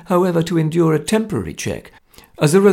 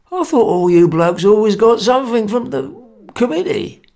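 put-on old Baker puzzled; 8 semitones higher, 5 dB louder than usual. A narrator's reading. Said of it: "said old Baker".

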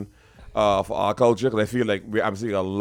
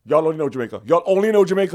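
exasperated retold complaint, rising louder; acted-out irritation, repeated more forcefully; annoyed; 6 semitones higher, 5 dB louder, faster than usual. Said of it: "y'all don't know how annoyed I used to be".

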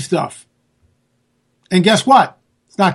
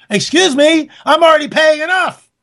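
indignant outburst; 7 semitones higher than usual. Real, not acted. Indignant about taxes.

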